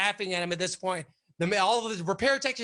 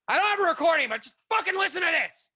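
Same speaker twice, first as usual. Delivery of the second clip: imitating an angry yell; 9 semitones higher, faster than usual. Boss yelling at the cops to listen, furious. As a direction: bark at the cops in exasperation.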